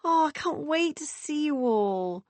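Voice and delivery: high, slow longing sister voice; longingly. A narrator's reading. Beth on the phone, high and slow with longing. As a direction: draw it out, wishing she were there. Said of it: "said Beth longingly".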